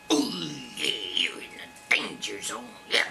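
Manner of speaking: quiet, breathy mock of Randy Savage's growl. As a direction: hiss it like a mocked Randy Savage. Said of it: "Randy Savage's bark".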